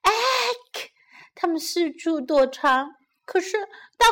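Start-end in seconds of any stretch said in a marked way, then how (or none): 0.0-0.9 high, small mock shriek; startled cry. A narrator's reading. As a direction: squeak in storybook alarm. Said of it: "小羊们惊叫着".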